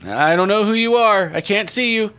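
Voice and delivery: high, loud character voice; high-voiced suspicious outsider; wary stranger. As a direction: call out high to someone unseen. Game master voices Edgar.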